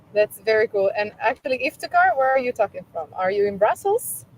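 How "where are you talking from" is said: normal talking voice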